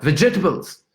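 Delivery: a frustrated quick "Vegetables"; sped up. Shown as a demonstration. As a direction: blurt "Vegetables" fast. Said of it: "try to speed it up".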